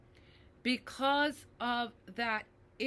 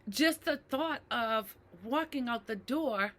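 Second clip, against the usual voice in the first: usual speaking voice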